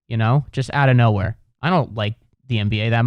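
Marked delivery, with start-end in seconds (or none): none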